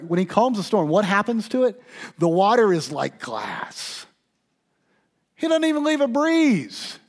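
high, loud, driving sermon climax; full-force preaching. Acted. Pastor preaching to congregation, loud passionate build.

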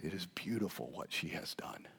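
whispered, soft and tender; whispered tenderness. Retold or imitated. Jesus praising the woman, tender whisper. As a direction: breathe it out as a gentle whisper. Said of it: "Oh, that's sweet".

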